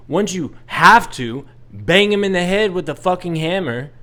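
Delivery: ordinary delivery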